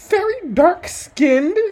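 normal talking voice

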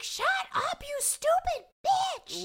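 very high, drawn-out mock scream; mock yelling. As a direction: screech it, imitating a woman having a breakdown. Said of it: "why are you yelling".

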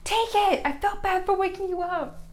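very high imitated insistence; insistent visitor. Student imitating visitor running off after paying, high and insistent. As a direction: voice him high, pushing the money on her.

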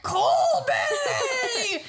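high, long drawn-out holler; mock summoning yell. Alvida showing up and calling for Colby, high mock bellow. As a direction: holler the name as a hammed-up villainess.

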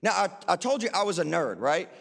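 normal talking voice

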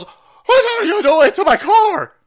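high, drawn-out mock shriek; mock outraged lady. Lady to thieves cutting her car, mock shriek. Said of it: "And this lady goes".

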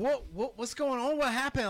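high, rising panicked questions; what's going on, said in bewildered alarm; confused panic. Retold or imitated. Confused drummer reacting onstage.

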